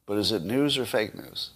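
usual speaking voice

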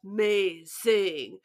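slow, stretched amazing; drawn-out amazed. Real, not acted. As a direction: savor the word amazing. Gushing about the car feature.

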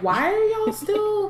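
high, slow, fed-up mock voice; exasperated nurse. Nurse fed up with injured students, high exasperated mock voice. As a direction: sing it out high like a nurse who is done with it all. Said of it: "She's just like".

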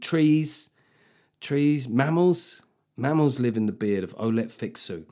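ordinary talk

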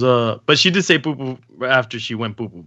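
normal talking voice

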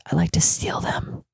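whispered naughty secret; hushed, voiceless confession; confessional whisper. Pretend. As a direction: whisper it like a guilty admission.

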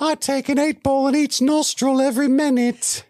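high squeaky elf, laughing through it; sassy Dobby. Retold or imitated. Co-host's Dobby brags to Harry, high and laughing. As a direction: squeal it with cheeky defiance.

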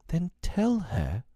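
put-on high, drawn-out girlish plea; begging. A narrator's reading. Wendy pleads with Peter about Tink's light, begging. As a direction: beg slowly in a high voice. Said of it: "Wendy begged".

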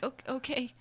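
soft, unsure mock agreement; mildly nervous. Waiter agrees to a baffling order, mildly nervous. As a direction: play a puzzled waiter quietly going along. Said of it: "like, nervously go to the back".

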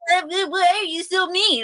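very high, whiny child imitation; mock a tearful kid's wail of complaint; upset crying kid. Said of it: "he gets upset and he's crying".

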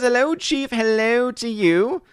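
put-on high, creaky old man voice; old man Gary voice. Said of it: "I also love doing the old man Gary voice".

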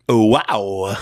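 drawn-out Walken-style wow; lightly voiced celebrity impression; Christopher Walken impression. Podcaster trying out a celebrity catchphrase, Walken impression. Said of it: "dropped a Christopher Walken wow".

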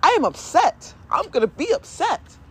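high, emphatic retold protest; re-enacted stubborn outburst; insistent upset. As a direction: insist on your right to be upset. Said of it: "can't control that emotion".

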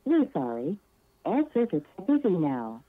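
ordinary talk

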